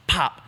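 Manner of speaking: sudden high-pitched vocal pop; short, punchy sound effect; imitated gunshot pop. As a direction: mimic a shot going off.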